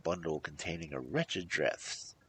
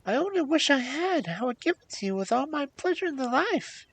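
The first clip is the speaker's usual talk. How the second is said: high, raised princess voice; earnest princess; 9 dB louder than usual. A narrator's reading. Princess assuring the hag she has nothing, earnest. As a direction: plead earnestly, pitched way up.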